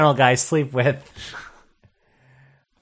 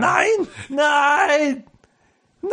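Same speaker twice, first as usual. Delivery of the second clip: high, stretched cries of refusal; mock German outcry; 11 semitones higher, much slower than usual. Comic imagines Hitler protesting, high mock German cry. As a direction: wail it high in a rough German accent. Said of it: "I can't do a German accent".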